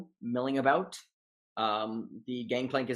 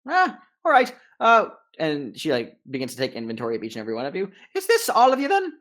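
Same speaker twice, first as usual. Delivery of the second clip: high, loud brisk gnome captain; 8 semitones higher, 5 dB louder, faster than usual. Acted. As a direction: haggle as a cheerful gnome captain.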